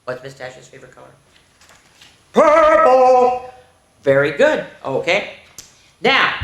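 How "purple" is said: higher, louder cheerful Purple; excited answer. Acted. Teacher cheers the answer for the kids. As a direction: announce the answer like a prize. Answering her own quiz.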